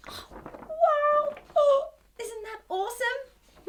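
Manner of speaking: high, loud wow of delight; amazed. Acted. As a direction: burst out in wonder. Amazed at poster reveal.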